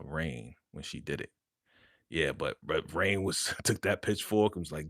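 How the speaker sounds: usual speaking voice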